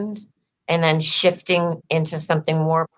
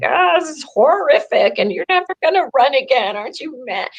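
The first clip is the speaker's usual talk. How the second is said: high-pitched imitation of alarmed callers; mock yelling; 8 semitones higher than usual. Friends calling to check on me, imitated yelling and screaming. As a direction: retell their screaming in a much higher voice. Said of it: "start yelling and screaming".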